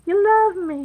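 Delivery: squealed high and loud; bright high squeal; high excited. Shown as a demonstration. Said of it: "That's what the purple heart to me means".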